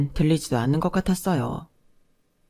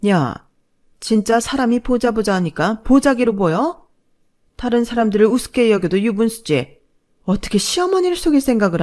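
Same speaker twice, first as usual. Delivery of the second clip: loud, higher scolding shout, peaking at the end; furious yelling; 5 semitones higher, 5 dB louder than usual. Acted. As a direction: tear into her at full volume. Mother-in-law yelling while pulling hair.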